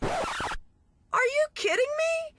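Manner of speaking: very high, slow incredulous squeal; stunned disbelief. Retold or imitated. Onlookers react to mother's excuse, squeaky disbelief. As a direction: stretch it out, jaw-dropped. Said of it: "with our jaws on the floor".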